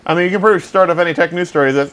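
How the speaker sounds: ordinary delivery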